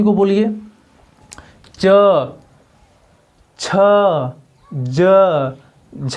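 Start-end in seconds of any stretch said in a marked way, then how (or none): 1.8-6.2 च, छ, ज, झ each said alone, tongue on the palate; teacher demonstrating च छ ज झ; slow demo of palatal sounds. Shown as a demonstration. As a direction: sound out च छ ज झ one by one with long pauses. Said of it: "अब इसको बोलिए आप लोग".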